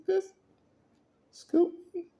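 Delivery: very high, loud, incredulous squeal; can't believe she was scooted; outraged disbelief. Retold or imitated.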